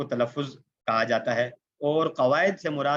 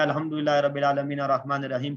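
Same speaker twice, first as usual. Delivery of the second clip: Arabic verse run together quickly; hurried recitation. Shown as a demonstration. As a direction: rattle through the recitation without separating words. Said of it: "اگر میں اس کو الگ الگ سے".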